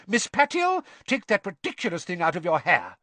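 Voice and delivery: high-pitched, loud telling-off; strict teacher's reprimand; Professor McGonagall snapping. A narrator's reading. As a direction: scold a pupil sharply. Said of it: "Professor McGonagall snapped".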